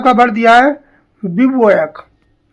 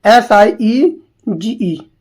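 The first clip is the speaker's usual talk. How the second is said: slow spelling; much slower than usual. Shown as a demonstration. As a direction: spell out each letter slowly, one by one. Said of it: "तो बिसीज". (धीरे-धीरे spelling बोलना)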